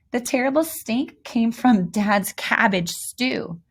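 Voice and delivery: ordinary delivery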